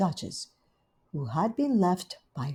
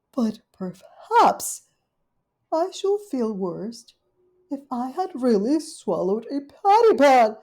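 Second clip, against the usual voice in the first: high, loud dismayed lady dog; fretting Duchess; 7 semitones higher, 9 dB louder than usual. Acted. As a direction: wring your paws over it. Duchess realises.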